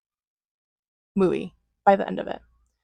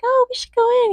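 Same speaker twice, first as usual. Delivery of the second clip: mock wife voice. Wife suggesting the club.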